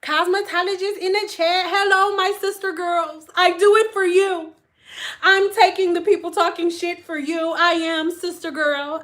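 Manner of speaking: high, loud mock speechifying; hammed-up champion of the people; jokey rallying address. Jokey address before "all jokes aside.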